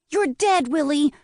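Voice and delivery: put-on high, loud boy at play; child's playful shout. A narrator's reading. Boy at play fires toy pistol.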